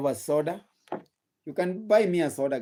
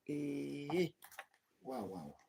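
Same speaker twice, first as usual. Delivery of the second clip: far off mic; 6 semitones lower, 19 dB quieter than usual. Real, not acted. Away from mic, drinking.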